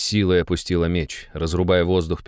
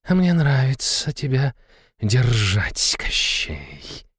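predatory hiss; 6 semitones higher, much slower than usual. A narrator's reading. Naga teasing Koschei as she holds him, predatory hiss. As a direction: hiss it through a predatory smile. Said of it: "прошипела она, хищно улыбаясь".

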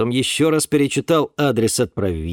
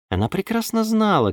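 ordinary delivery

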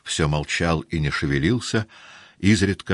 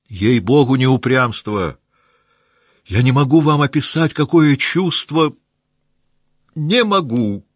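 much higher, louder, strained cry; desperate protest; 9 semitones higher, 7 dB louder than usual. Acted. Rostov crying out his refusal, high and loud. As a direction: plead that you cannot.

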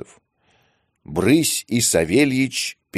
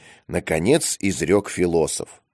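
ordinary delivery, nothing marked

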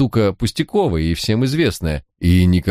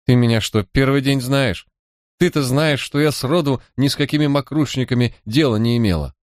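put-on higher, indignant street-girl voice; a sulky young crook, pitched up and wronged; lightly voiced defiant girl, higher than the narrator; Manya, young thief; 7 semitones higher than usual. A narrator's reading.

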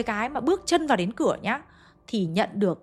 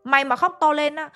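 higher, louder scolding bark; retold angry shout; 5 semitones higher, 3 dB louder than usual. Uncle shouting at the crying mother, a higher, louder scolding. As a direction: snap at her to stop crying. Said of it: "Ông bác thấy thế mới quát".

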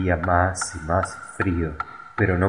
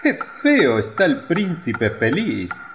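pompous mayor; 9 semitones higher than usual. A narrator's reading. Mayor voiced in story.

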